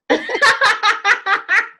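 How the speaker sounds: high, ringing burst of laughter; real laughter. Real, not acted. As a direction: crack up at your own line. Laughs at own empath announcement.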